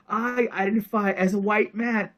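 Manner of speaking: usual speaking voice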